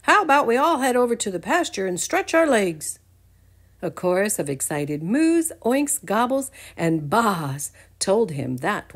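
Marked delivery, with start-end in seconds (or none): 0.0-3.0 higher, louder hearty greeting; friendly Farmer Dan. Acted. Farmer Dan greeting Buttercup, hearty and friendly. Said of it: "Farmer Dan said".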